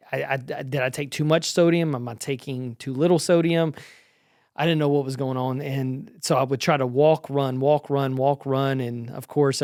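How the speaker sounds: ordinary talk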